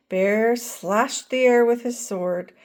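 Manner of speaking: usual speaking voice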